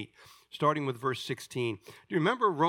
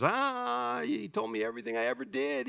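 astonished woman; 9 semitones higher than usual. Acted. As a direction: gasp out the discovery in a raised voice. Acting astonished woman at well.

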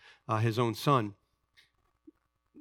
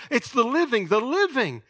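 "living" pitched higher and hit twice; stress on living; 10 semitones higher, 5 dB louder than usual. Acted. Preacher stressing "living" to the congregation, emphatic. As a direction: hammer the word "living".

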